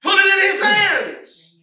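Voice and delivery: loud, high, driving exhortation; rally the congregation; preaching at full force. Acted. Preacher urging the church to give it to Jesus, fervent.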